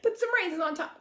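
plain reading voice

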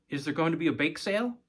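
put-on quick eager kid voice; eager child. Six-year-old asking her father about the bake sale, eager.

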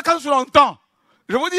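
usual speaking voice

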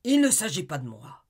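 loud, cutting shout; angry shout. Acted. Husband cutting off his wife, with an angry shout. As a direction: shout her down. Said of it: "cria-t-il".

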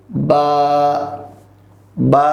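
বা held long on the vowel; drawn-out letter. Shown as a demonstration. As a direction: model বা slowly stretched for learners. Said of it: "এভাবে করে বলবো।". (বা টেনে টেনে বলা)

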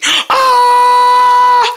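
high, loud, long pretend scream; falling scream. Racer plummeting toward another, falling scream. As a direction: scream steadily on one high note.

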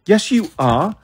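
put-on insistent child's rebuttal; insistent arguing. A narrator's reading. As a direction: argue back earnestly. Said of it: "I argued".

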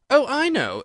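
high, loud sudden exclamation; eager little cry of discovery; exclaiming. A narrator's reading. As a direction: blurt it out, suddenly remembering. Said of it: "exclaimed Alice".

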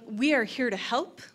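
ordinary delivery

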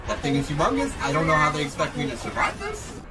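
loud frantic disbelief; adrenaline rush. Real, not acted. Panicking at the huge creature.

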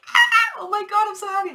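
high, loud squeal of joy; mock screaming kid. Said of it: "Like that kid that was screaming".

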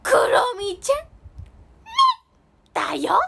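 high, loud cartoon mascot voice; My Melody. Mascot impression performed for viewers, high and loud character voice. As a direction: squeal it like a cute animated mascot. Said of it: "マイメロちゃんのモノマネ。".

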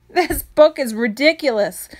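ordinary delivery

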